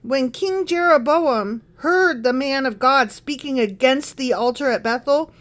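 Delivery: ordinary talk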